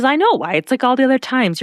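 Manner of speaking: mildly fed-up comeback; exasperated retort. Retold or imitated. Luke to Rachel as she leaves, exasperated.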